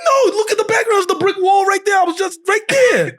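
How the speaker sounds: very high, half-shouted retold insistence; excited protest. Husband proving to wife he just posted, high excited protest. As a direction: plead your case in a high voice.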